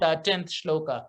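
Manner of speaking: ordinary talk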